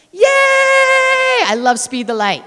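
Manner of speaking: high, loud shout of joy; thrilled shout for the donors; excited cheer. Real, not acted. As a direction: cheer it out to the crowd. Cheering for the sponsoring program.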